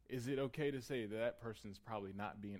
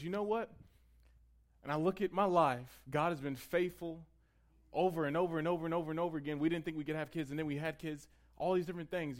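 raised, loud preacher's delivery acting out Abraham; impassioned testimony; 5 semitones higher, 7 dB louder than usual. Preacher voicing Abraham recalling God's faithfulness, impassioned. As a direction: build conviction with louder, higher voice.

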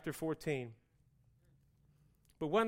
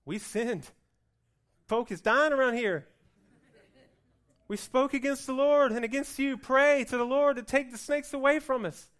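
desperate plea; 6 semitones higher, 8 dB louder than usual. Acted. People pleading with Moses.

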